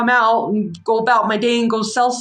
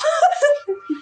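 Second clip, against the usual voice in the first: high-pitched real laugh. Real, not acted. Laughing at her own story, high giggle.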